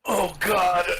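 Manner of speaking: embarrassed "Oh, God"; mortified gasp. Retold or imitated. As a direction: realise the blunder mid-breath. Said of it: "And I was like".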